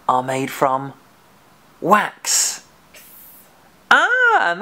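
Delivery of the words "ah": delighted discovery. Acted. Finds the candle is wax.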